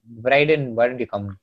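ordinary talk

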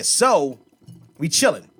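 ordinary talk, nothing marked

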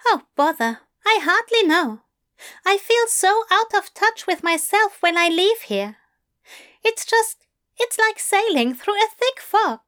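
mildly vexed, fussy angel; irritated Aziraphale. Acted. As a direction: huff about the fog in his head. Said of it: "gave way to open irritation".

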